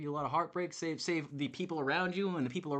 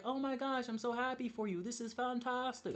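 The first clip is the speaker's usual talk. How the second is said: higher, bright fake enthusiasm; fake excited; 6 semitones higher, 4 dB quieter than usual. Shown as a demonstration. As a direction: gush it, clearly put on. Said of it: "I don't sound like that".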